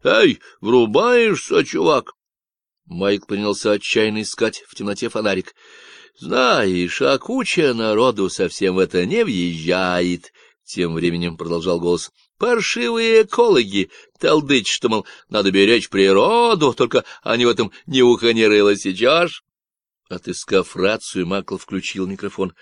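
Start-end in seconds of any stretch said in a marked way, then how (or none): 0.0-2.1 put-on higher, slow Texan accent, talked through the nose; a lazy Texan voice on the walkie-talkie; Texan drawl. Stranger on the radio lecturing Michael, nasal Texan accent. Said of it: "с техасским акцентом". 6.2-19.4 loud, nasal Texan ranting about ecologists; a cocky stranger sounding off; Davy's Texan voice. Acted. Stranger on the radio ranting about ecologists, nasal Texan.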